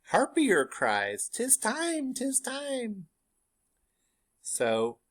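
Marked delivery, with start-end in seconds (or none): 0.1-3.1 high eerie witch voice calling the time; shrill high witch cry. Acted. Teacher voices the third witch, high eerie call. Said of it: "the third witch says".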